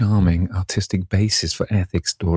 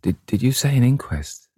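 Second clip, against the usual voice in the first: higher-pitched, halting, horrified questions; distressed pleading in a raised pitch; stammering, stifled; 5 semitones higher than usual. A narrator's reading. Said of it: "he stammered in a stifled voice".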